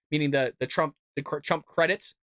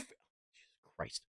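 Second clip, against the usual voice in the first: breathy whisper under the breath; whispered curse; 20 dB quieter than usual. Real, not acted. Vlogger reacting to a politician's clip, whispered curse. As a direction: swear to yourself, barely audible. Exasperated aside while playing a clip.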